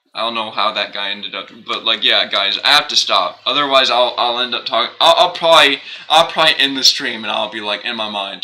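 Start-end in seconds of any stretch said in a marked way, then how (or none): none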